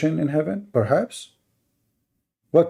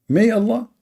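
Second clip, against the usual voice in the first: mocking disbelief; 9 semitones higher than usual. Real, not acted. Mocking the phrase.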